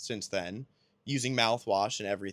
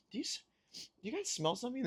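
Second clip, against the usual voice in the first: higher, quick, softer repeated question; imitating Delia; 11 semitones higher, 8 dB quieter, faster than usual. Wife asking nurse and husband about a smell, hushed high mock. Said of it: "Delia's just going".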